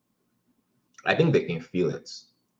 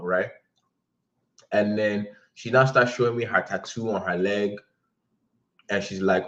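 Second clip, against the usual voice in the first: normal talking voice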